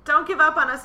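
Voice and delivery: high, loud appeal; mock desperate plea. Host to listeners as ratings drop, mock desperate plea. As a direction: call out loudly, begging playfully.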